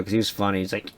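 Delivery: ordinary talk, nothing marked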